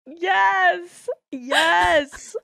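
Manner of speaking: high, exclaimed real approval; delighted cheer. Real, not acted. Host cheering on her friend's dirty joke, real high delight. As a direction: cry out in gleeful agreement.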